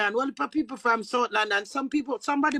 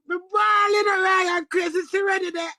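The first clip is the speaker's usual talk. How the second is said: retold crying; 5 semitones higher, 4 dB louder than usual. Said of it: "And I'm gonna say".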